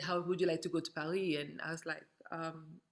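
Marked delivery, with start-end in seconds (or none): 0.0-1.4 lightly imitated French accent, Paris as "Paree". Shop owner's wife offering a trip, in a light French accent. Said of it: "but more in a French accent".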